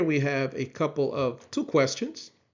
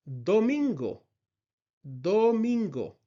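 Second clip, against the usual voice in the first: ordinary delivery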